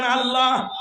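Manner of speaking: a fervent called-out name; loving call. Shown as a demonstration. Preacher leading the crowd's call, loud loving cry. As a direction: call Allah out loud with love. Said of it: "ভালোবেসে ডাক দেন".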